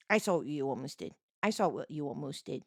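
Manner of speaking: low, quick playful warning; mock warning. Playfully catching someone out.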